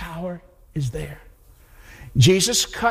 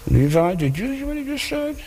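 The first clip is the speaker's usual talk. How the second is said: higher, hushed gossiping voice; a scribe whispering to his neighbour in disbelief; 7 semitones higher than usual. Retold or imitated. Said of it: "them saying to one another".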